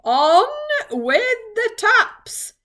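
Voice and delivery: long, high bellowed command; shout. A narrator's reading. Voice on top of the cloud repeats its order, shouting. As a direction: call it out very slowly and loudly. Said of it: "it shouted".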